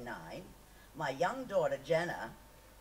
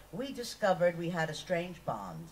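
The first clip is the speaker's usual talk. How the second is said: ordinary delivery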